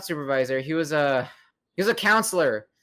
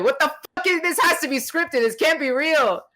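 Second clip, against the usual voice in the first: disbelieving squeal; 10 semitones higher than usual. Retold or imitated. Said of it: "he's like".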